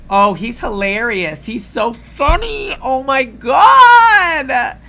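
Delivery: high, drawn-out sarcastic wail; fake-swoon over the joke, loud at the end; sarcastic mock gushing. Real, not acted. Sarcastic mock of laughing crowd.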